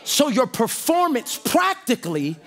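rising shouted preaching cadence. Acted. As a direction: preach it to the congregation at full force. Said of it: "I'm preaching up here, y'all".

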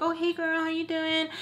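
high sugary fake greeting; imitating a fake-nice friend; fake friendly greeting. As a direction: sweeten it up, phony girlfriend hello.

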